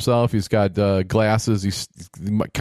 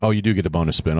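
ordinary talk

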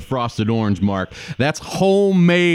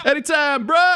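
surfer bro; 13 semitones higher, 4 dB quieter than usual. Pretend. Bro sign-off.

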